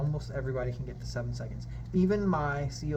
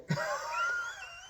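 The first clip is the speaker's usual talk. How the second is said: hearty belly laugh; 4 dB quieter than usual. Shown as a demonstration. Teacher shows a diaphragm laugh, a hearty belly laugh. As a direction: laugh hard from the gut to show the breath. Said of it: "just go like this, go".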